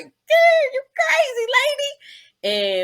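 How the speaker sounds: high, loud cry of disbelief; shocked shout. Retold or imitated. Kid watching mom take the corner on two wheels, shocked shout. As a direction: yell at your mom's wild driving. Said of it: "I was looking at my mom like".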